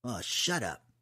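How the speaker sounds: growled brush-off to a brother; annoyed growl. A narrator's reading. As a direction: growl it, unimpressed by the teasing. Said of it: "he growled at Flanny".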